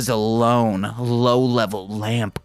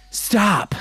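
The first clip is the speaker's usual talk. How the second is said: high sharp command from the shadows; husky command; 10 semitones higher than usual. A narrator's reading. Narrator voicing a hidden figure ordering stop. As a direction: bark it out, pitched high. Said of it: "a husky voice commanded".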